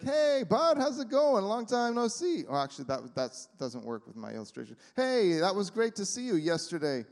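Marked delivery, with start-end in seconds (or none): 0.0-2.4 cheery friend greeting. Retold or imitated. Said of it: "you're like". 5.0-7.0 cheery friend greeting. Retold or imitated. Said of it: "you're like".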